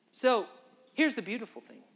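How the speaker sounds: normal talking voice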